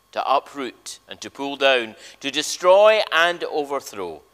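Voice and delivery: usual speaking voice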